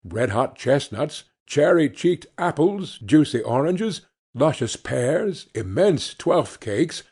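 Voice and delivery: usual speaking voice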